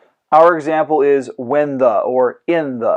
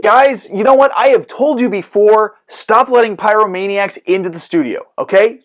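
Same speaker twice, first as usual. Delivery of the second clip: higher, louder fed-up scolding; exasperated scolding; 7 semitones higher, 4 dB louder than usual. Acted. Host scolds crew for letting pyromaniacs in, exasperated.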